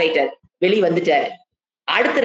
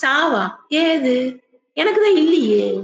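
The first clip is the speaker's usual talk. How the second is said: higher, incredulous questions; mock disbelief, higher; scoffing sage; 5 semitones higher, slower than usual. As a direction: laugh off death itself. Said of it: "அவருடைய internal dialogue என்ன இருக்கும்".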